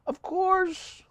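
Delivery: very high, soft falsetto "Of course"; mock falsetto. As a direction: squeak "Of course" up in falsetto, softly. Repeats thanks in mock voice.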